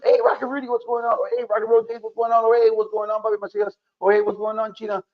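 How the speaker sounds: chirped, retold hey-what's-up; imitated fan greetings. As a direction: greet them like passing acquaintances. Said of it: "you walk up to and".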